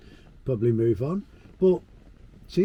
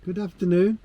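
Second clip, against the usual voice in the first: bright, upbeat hello to camera; cheery greeting; 5 semitones higher, 6 dB louder than usual. Real, not acted. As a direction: call out a cheerful welcome. Cheery vlog intro greeting.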